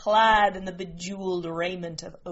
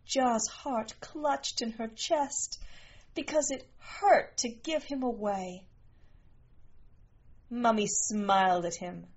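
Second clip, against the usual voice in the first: ordinary delivery, nothing marked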